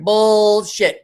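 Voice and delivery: loud mock disbelief; loud scoff. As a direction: call out the excuse with a loud scoff. Calling out friend's excuse.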